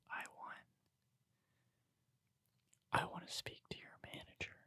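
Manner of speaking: barely audible whisper; hushed imitation of the customer; no longer yelling. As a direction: drop to a very soft, whispered demand. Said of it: "He stopped yelling and he said".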